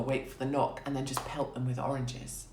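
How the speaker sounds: usual speaking voice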